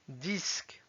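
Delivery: "Disque" as Russian "disk"; Russian pronunciation. Shown as a demonstration. Teacher reading words with learners, "Disque" in Russian. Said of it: "s'entraîner à lire les mots".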